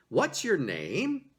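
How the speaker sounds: slow "What's your name" rising on "name"; rising question intonation. Shown as a demonstration. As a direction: stretch it out and lift "name" at the end. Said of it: "there is a rising intonation, an upward inflection on the last word".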